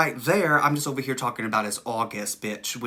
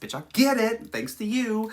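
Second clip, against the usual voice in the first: excited high; 9 semitones higher than usual. Real, not acted. Excited reaction.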